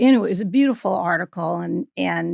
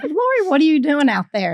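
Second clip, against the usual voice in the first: high, louder teasing call; mock-jovial needling, pitched up; 7 semitones higher, 5 dB louder than usual. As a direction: imitate a joker calling out to a friend. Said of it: "sort of make fun of me".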